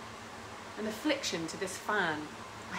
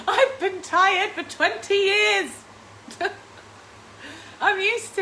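laughing through the words, slow and loud; 9 semitones higher, 6 dB louder, slower than usual. Real, not acted. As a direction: laugh it out, slower and louder. Laughing at own tiredness.

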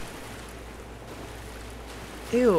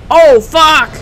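loud, higher-pitched startled curse; real jolt of alarm; startled yell; 5 semitones higher, 10 dB louder than usual. Real, not acted. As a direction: yell it out as the threat appears. Sudden scare in the game.

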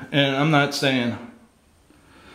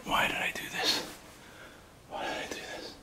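whispered regret, fading quieter; despairing whisper; 14 dB quieter, faster than usual. Real, not acted. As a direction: whisper it to yourself in dread. Regretting coming in, terrified.

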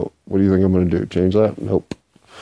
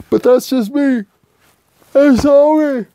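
high silly voice; 5 dB louder, slower than usual. Acted. Sing-song self-mocking lines.

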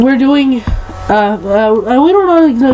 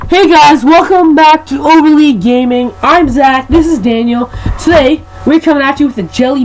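YouTuber intro; 3 semitones higher than usual. Acted.

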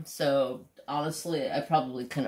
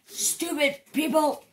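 imitating the boss; 13 semitones higher than usual. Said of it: "my boss turns around and goes".

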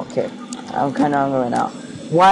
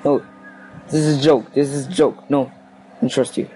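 usual speaking voice

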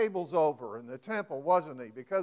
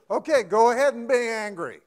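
permissive; 4 semitones higher, 8 dB louder than usual. Shown as a demonstration. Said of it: "It's like this".

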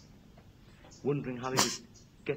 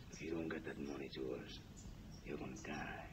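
low, soft menacing mobster; mafia boss voice; 9 semitones lower, 10 dB quieter than usual. Acted. Mafia boss threatening the man who owes him money, low and quiet. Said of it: "he turned into the mafia with that voice".

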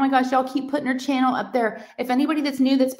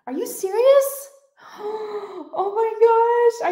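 high-pitched squeal of disbelief, gasping; stunned delight; 13 semitones higher, 4 dB louder, slower than usual. Real, not acted. Streamer seeing her friends' channel hit a thousand, squealing in disbelief. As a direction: shriek with real shock and joy.

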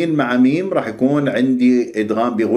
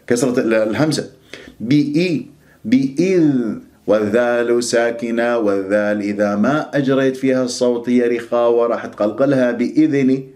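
ordinary talk

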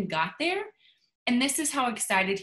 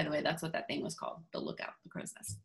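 hushed aside; 7 semitones lower, 12 dB quieter, faster than usual. Real, not acted. Hushed aside explaining a word.

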